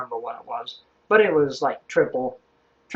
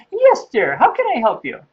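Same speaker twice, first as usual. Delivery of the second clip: high, loud English nanny voice; put-on high English lady, helpful; Missus Doubtfire; 7 semitones higher, 4 dB louder than usual. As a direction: trill it like a hammed-up English matron. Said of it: "he did a pretty good job of playing".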